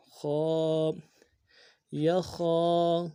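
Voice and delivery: ordinary delivery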